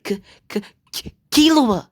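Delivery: overwhelmed reunion cry, acted; emotional stammered outcry. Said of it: "Deku starts shedding a bunch of tears".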